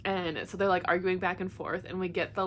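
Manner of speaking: ordinary delivery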